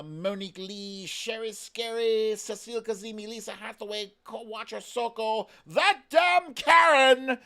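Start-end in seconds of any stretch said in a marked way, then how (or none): 5.7-7.4 loud, high mock outburst at a username; hammed-up indignant yell; mock outrage. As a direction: blurt the name like an offended neighbour. Reading a funny username aloud.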